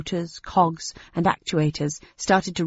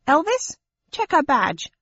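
high alien voice; 9 semitones higher than usual. Acted. Small alien giving order.

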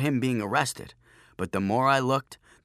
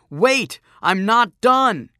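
high, loud, drawn-out protest; yell; 10 semitones higher, 9 dB louder, slower than usual. A narrator's reading. Joshua dragged toward the gate, yelling in protest. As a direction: holler it while being hauled away. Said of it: "Joshua yelled".

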